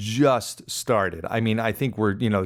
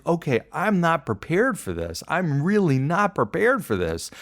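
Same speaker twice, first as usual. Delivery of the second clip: higher, mildly whiny worry; a touch of mock despair, pitched up; retold panic; 7 semitones higher than usual. Swimmer admitting he is out of his depth, mildly panicked. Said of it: "in a full-blown panic".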